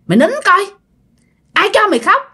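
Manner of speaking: high, harsh spirit voice; spirit scolding the girl. Retold or imitated. Spirit scolding possessed girl to stop crying, high harsh voice. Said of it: "Bà mới nói vậy nè".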